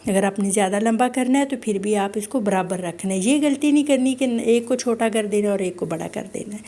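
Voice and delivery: normal talking voice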